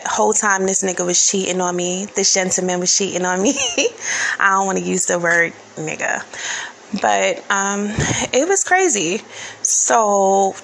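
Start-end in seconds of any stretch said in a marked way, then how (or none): 3.6-4.3 a sudden high burst of laughter; short high giggle. Real, not acted. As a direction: laugh it off, amused at yourself. Laughs at her own word choice.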